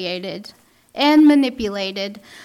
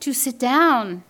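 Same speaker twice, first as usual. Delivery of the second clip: ordinary delivery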